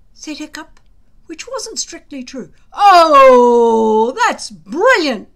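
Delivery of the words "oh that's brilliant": a sarcastic bellow, much louder and slow; bellowed sarcasm. A narrator's reading. Gobber mocking Hiccup in front of the boys, sarcastic bellow. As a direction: roar it out, mocking. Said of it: "bellowed Gobber".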